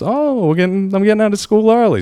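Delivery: put-on high, bright voice of his young daughter; imitating his daughter happy to leave school early; excited little girl. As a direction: play the thrilled kid leaving school early. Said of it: "she, of course, was happy to see us".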